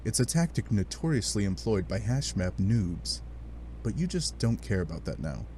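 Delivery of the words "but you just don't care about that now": usual speaking voice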